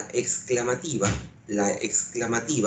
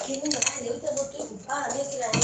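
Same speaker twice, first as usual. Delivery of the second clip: put-on girl's voice; 14 semitones higher, 5 dB quieter, much faster than usual. Teacher voices a girl answering.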